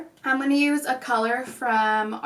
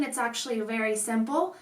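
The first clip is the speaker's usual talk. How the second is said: normal talking voice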